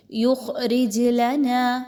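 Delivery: یخرج with a heavy خ and a bounced ج, very slow; teacher models qalqala in یخرج; bounced jeem. Shown as a demonstration. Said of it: "اس را کو باریک پڑھیں گے".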